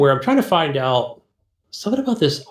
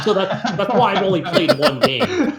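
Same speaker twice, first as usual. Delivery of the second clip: higher-pitched, words carried on a laugh; 7 semitones higher than usual. Real, not acted. Co-host explaining his one game to a friend, laughing. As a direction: laugh your way through the admission. Owns up amid shared laughter.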